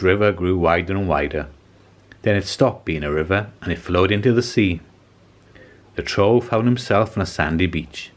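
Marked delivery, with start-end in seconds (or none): none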